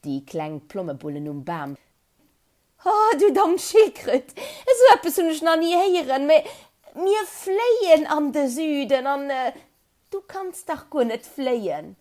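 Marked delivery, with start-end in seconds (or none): 2.8-12.0 high, loud giggling mockery; laughing, teasing chirps; giggling birds. A narrator's reading. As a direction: birds cackling at the silly tortoise. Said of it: "wat se do gekickelt hunn".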